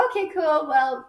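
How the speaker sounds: high, cheery mock sign-off; breezy brush-off. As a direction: pitch it up brightly to escape politely. Acting out her awkward exit.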